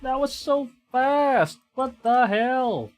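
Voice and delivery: loud, high, sudden cry of alarm; shocked gamer yelling at the screen; startled shout. Real, not acted. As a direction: yell as the monster jumps you. Startled by a fast creature.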